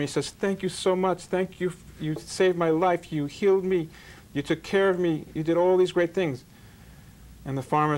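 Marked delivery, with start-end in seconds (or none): 0.3-6.4 gushing thanks. Retold or imitated. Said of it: "He's gushing to the farmer".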